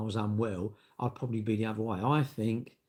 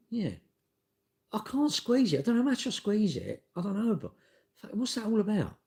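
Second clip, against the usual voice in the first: high, puzzled put-on voice; baffled inner voice; 12 semitones higher than usual. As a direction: wonder aloud in a high voice. Voicing own thoughts at shop.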